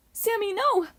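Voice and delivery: very high urgent protest. A narrator's reading. Hazel stopping Sammy taking the diamond, high protest. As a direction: protest in alarm. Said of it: "Hazel protested".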